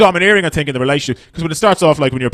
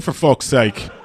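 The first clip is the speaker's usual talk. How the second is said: ordinary talk, nothing marked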